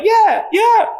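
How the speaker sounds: high-pitched cheering along; mock hype. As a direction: whoop it like a hyped fan. Acting out excited cameo reaction.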